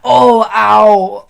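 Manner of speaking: high, loud fake ouch; mock yelp of pain. Vlogger acting out skin tearing, loud mock ouch. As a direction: yelp as if scratched.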